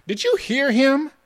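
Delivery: very high, loud indignant question. A narrator's reading. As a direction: voice shooting up in outrage. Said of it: "Jakey's voice had risen yet higher in indignation".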